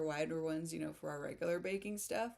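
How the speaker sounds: ordinary delivery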